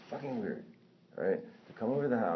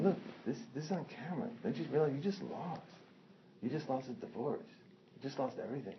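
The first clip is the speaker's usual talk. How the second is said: pleading argument in a higher pitch; retold confrontation; 7 semitones higher, 6 dB quieter than usual. Retold confrontation, lecturing the intruder.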